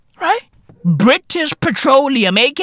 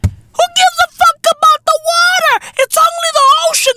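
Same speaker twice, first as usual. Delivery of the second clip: mocking voice; 4 dB louder than usual. Mocking oil company's contempt.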